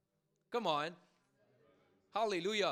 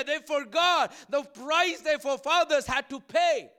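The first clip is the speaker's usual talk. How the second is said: impassioned preaching; 3 semitones higher, 7 dB louder than usual. Real, not acted. Preacher reproaching a people who forgot, impassioned preaching. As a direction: build to loud, insistent preaching.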